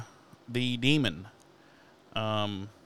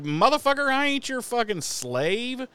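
retold angry retort; 11 semitones higher than usual. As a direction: fling it back at him like a mock tirade, pitched up. Cordelia retold, snapping at Xander.

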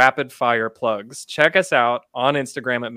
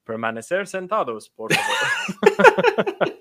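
ride announcer in Spanish; 6 semitones higher, slower than usual. Retold or imitated. Spoof of ride safety spiel.

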